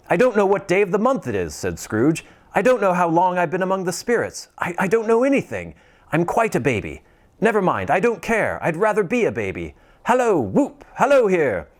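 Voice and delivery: high, fast, giddy calling out; giddy Scrooge. Acted. Scrooge shouting merry wishes to the whole world, giddy. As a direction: bubble over like a schoolboy.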